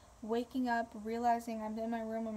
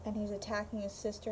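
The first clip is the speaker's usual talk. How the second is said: usual speaking voice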